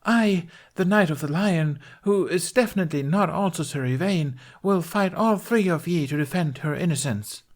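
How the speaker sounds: high, grand stage proclamation; proclaiming knight. A narrator's reading. Knight challenges all three accusers, proclaiming high.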